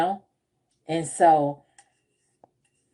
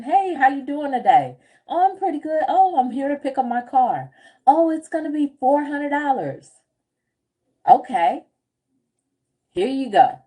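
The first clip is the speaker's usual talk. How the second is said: acted chat; 5 semitones higher than usual. Acting out tow lot exchange.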